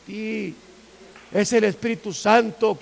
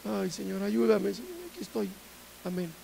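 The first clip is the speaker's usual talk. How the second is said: soft, listless mock prayer; feebly going through the motions; cold, dry prayer; 6 dB quieter than usual. Shown as a demonstration. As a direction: mumble a half-hearted prayer. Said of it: "oraciones tan frías".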